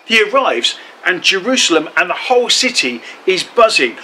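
ordinary delivery

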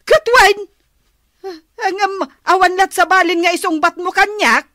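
loud, high, quick scolding; angry nagging. Acted. Wife nagging Enteng for not answering, loud and fast. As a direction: rattle off the scolding.

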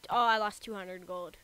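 usual speaking voice

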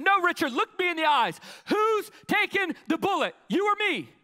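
high, loud shouted demand; furious high-pitched confrontation; exploding right back. Acted. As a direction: shout it in his face, forcing an answer. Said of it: "I explode right back in his face".

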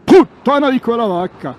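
shouted curse, high and loud; loud swearing. Real, not acted. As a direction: yell it out in frustration. Arriving at the freezing pass. (imprecazione urlata)

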